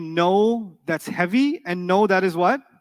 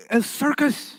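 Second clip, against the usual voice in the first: usual speaking voice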